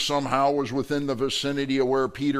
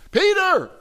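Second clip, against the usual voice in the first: high, loud call of the name; sudden shout; 8 dB louder than usual. Acted. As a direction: cry "Peter!" sharply, pitched way up. Startled accuser calls out Peter.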